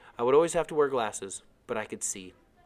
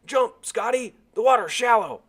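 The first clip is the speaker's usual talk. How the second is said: loud, higher shout; yelled; 12 semitones higher, 10 dB louder, slower than usual. Acted. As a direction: call out from the boat so he jumps. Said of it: "Someone yelled".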